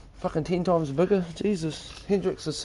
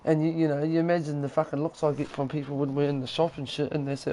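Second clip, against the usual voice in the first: usual speaking voice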